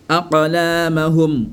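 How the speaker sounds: "Aqlamahum" slow, a-qa-laamahum; qalqala. Shown as a demonstration. Teacher recites the example, qalqala. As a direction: bounce the q of "Aqlamahum", hold laa. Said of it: "Avec qalqala".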